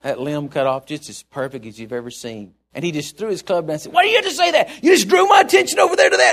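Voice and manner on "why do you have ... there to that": much higher and louder, a mock exasperated shout; hammed-up golfer venting after a bad shot; exasperated outburst. Pastor yelling at the golf partner who jinxed his shot, mock exasperated shout. Pastor throws club, complains.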